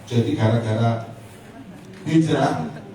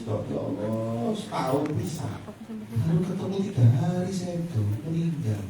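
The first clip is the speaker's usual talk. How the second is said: mock tearful lament; 5 semitones higher, 4 dB quieter than usual. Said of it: "Ummu Salamah nangis". (meratap pelan, pura-pura menangis)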